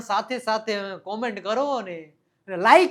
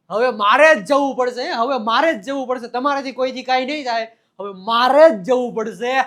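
louder, proud hero's boast; mock heroic declaration; 2 semitones higher, 7 dB louder than usual. Hero boasting to the beaten fighters, loud and proud. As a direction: announce it like the film hero arriving.

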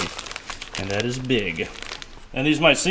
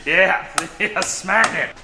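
laughing; 8 semitones higher, 4 dB louder, much slower than usual. Real, not acted. Giddy over meaty slap.

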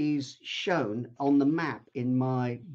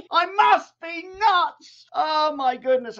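laughing disbelief; 7 dB louder, slower than usual. Real, not acted. Laughing at his own folly.

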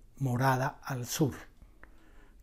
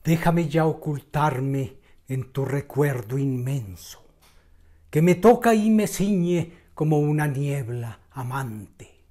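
impassioned declamation, swelling louder on each appeal; poetry recital; 3 semitones higher, 8 dB louder than usual. Acted. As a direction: declaim the poem with measured pauses, sinking at stanza ends. Recita el poema anunciado. (recitación poética declamada con pasión)

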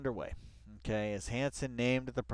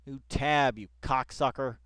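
normal talking voice

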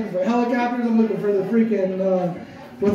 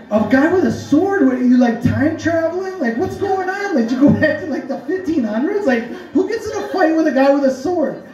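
high-pitched, animated disbelief; retold flabbergasted reaction, voice jumping up; incredulous; 6 semitones higher than usual. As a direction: fire off baffled questions at a friend. Retold reaction to absurd sword story.

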